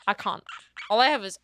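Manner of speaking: normal talking voice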